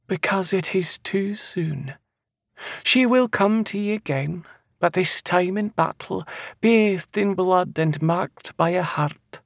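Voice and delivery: high, slow Scottish prophetess; eerie seer. Acted. Seer foretelling a man's future, high and eerily certain. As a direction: intone the prophecy high and drawn out.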